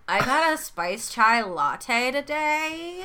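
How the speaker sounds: put-on slow American Valley Girl drawl with vocal fry; creaky Valley Girl American accent. Shown as a demonstration. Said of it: "full American Valley Girl vocal fry".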